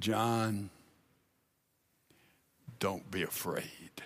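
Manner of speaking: very soft, low and hushed; quietly calm a frightened friend; gentle reassurance. Retold or imitated. Jesus reassures terrified John in vision.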